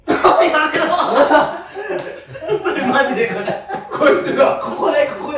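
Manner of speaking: high and loud, cracking up; laughing. Real, not acted. Teased member laughs at friends mocking his face, loud laughter. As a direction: laugh while protesting to friends.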